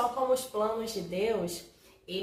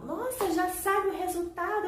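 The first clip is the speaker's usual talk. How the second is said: curious friends; 9 semitones higher than usual. Retold or imitated. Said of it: "e as pessoas falavam".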